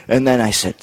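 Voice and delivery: normal talking voice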